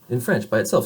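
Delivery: ordinary delivery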